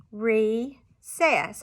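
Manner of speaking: "recess" split into re and cess with a clear gap; chopped syllables. Shown as a demonstration. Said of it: "chop those syllables".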